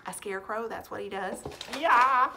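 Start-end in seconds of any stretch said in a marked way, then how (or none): 1.8-2.3 sudden loud, higher burst; playful scare shout. Pretend. Teacher showing what a scarecrow does, sudden mock scare shout. Acting out scaring the crows.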